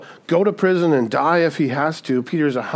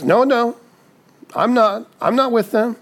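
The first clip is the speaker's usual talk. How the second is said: a hint of panicked denial; 8 semitones higher than usual. Peter retold denying Jesus.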